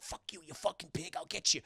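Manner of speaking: soft, under-the-breath mock threat; muttered cursing. Penguin flips off the departing cop, muttered cursing. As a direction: curse him so he can't hear.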